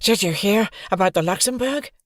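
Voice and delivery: a worried friend blurting the news; agitated boy. A narrator's reading. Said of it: "Scooter's agitated voice boomed".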